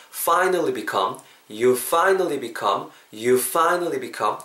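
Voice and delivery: very slow, lip scraped on the F of "finally"; slow F drill. Shown as a demonstration. Teacher drills the F sound slowly for repeating. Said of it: "입술 긁으면서".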